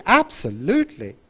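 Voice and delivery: big swoop on the "lute" of "absolutely"; wide intonation. Shown as a demonstration. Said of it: "use a very wide intonation".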